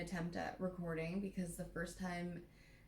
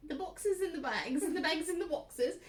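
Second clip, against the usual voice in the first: higher and louder, piling it on in mock exasperation; building up the joke about the boxes; excited exclaiming; 8 semitones higher, 6 dB louder than usual. Real, not acted. Teasing her friend about the boxes, excited and loud.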